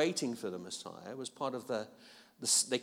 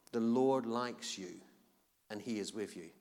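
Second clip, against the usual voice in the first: tender blessing; 5 semitones lower, 5 dB quieter than usual. Acted. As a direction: speak it gently to the listener as a promise. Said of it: "Who needs to hear that today?".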